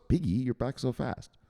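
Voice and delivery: ordinary talk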